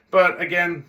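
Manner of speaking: ordinary talk, nothing marked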